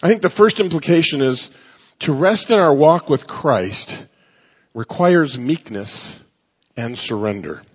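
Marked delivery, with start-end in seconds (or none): 2.0-7.6 slow emphatic. Shown as a demonstration. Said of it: "slow emphatic first take".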